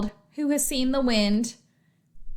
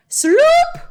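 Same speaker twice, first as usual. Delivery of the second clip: loud, drawn-out rising slurp; long "sloop" sound effect; slurping noise; 8 dB louder than usual. A narrator's reading. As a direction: imitate something sucked down a drain. Imitates newt slipping down drain.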